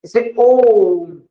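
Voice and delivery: Om held very long and slow; drawn-out Om. Shown as a demonstration. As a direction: stretch the O of ओम like a chant. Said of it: "एक और स्वर होता है जो कभी कभार question में पूछा जाता है".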